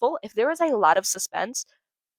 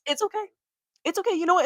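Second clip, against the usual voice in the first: high reassuring; 9 semitones higher, faster than usual. Real, not acted. Self-consoling, high pitched.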